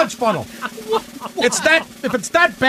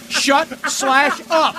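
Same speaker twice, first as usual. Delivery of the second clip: slow, flat brush-off; deadpan dismissive; 3 semitones higher, much slower than usual. Acted. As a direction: drag out the dismissal. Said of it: "Here's my pronouns".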